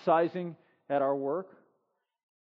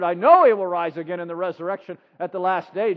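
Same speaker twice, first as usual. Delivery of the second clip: louder, insistent Martha; Martha answering; 3 semitones lower, 6 dB louder than usual. Acted. Martha insisting to Jesus, firm and louder. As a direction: answer him like a sure believer. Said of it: "Martha answered".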